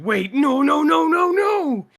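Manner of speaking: high, loud, drawn-out wail of no; escalating panicked protest, climbing high and loud; mock panicked cry. Game master mimicking a player's sudden alarm, mock panicked cry. Retelling a player's frantic reaction.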